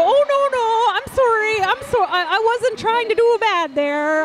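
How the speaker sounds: mock Minnesota-nice "oh no, I'm sorry" in a Minnesotan accent; playful high Minnesotan accent, fussy and apologetic. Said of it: "I become Minnesotan when I talk about the Canadian cut".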